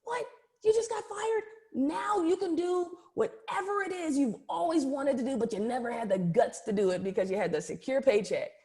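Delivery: high, thrilled imitation of her own past self; excited disbelief. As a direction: squeal it like a friend just got great news. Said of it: "Because I'm like".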